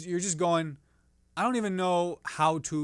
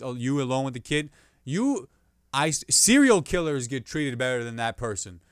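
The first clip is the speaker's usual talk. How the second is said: normal talking voice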